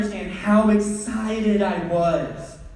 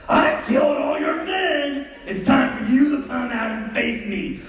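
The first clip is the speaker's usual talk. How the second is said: action hero shout; 6 semitones higher than usual. Pretend. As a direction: call him out like a movie villain. Kid acting action-movie villain.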